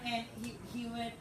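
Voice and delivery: ordinary talk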